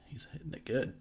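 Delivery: hushed, breathy aside under the breath; whisper. Real, not acted. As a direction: whisper it like a secret. Whispered comment while watching.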